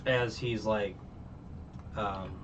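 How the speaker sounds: usual speaking voice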